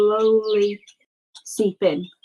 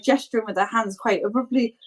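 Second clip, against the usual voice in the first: ordinary delivery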